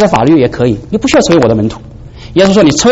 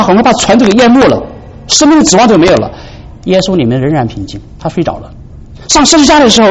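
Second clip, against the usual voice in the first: ordinary talk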